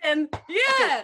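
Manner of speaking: real excited cry; excited yell. Real, not acted. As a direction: shout yeah, thrilled. Recognising a shared colleague.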